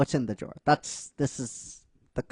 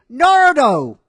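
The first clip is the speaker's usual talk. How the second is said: much higher, much louder call of the name; angry yell; 11 semitones higher, 12 dB louder than usual. Retold or imitated. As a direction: bellow his name from the house. Ino yells from inside.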